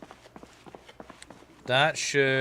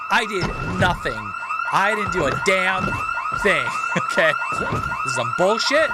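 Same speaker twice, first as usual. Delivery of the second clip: panicked denial. Real, not acted.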